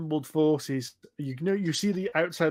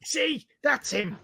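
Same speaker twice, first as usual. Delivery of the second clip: pompous goblin; 3 semitones higher, 4 dB louder than usual. Acted. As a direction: insist in a raised goblin voice. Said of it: "this little pompous looking goblin".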